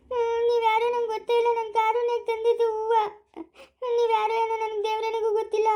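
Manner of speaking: high, tearful wail; crying. Acted. Disowning a stranger who got the rose, crying. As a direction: wail the words through put-on sobs. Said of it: "ನೀವ್ ಅಳ್ಬೇಡಿ".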